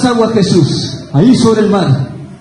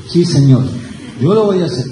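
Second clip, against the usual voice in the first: a much lower, humble yes-sir voice; meek Peter; 11 semitones lower than usual. Acted. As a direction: answer your master meekly, head bowed. Peter meekly answers Jesus.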